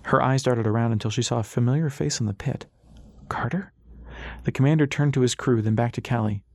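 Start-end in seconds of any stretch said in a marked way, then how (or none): 3.3-3.7 higher, soft questioning call; hopeful startled whisper of a name; surprised recognition. A narrator's reading. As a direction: spot a friend in a crowd. Spots familiar face, calls.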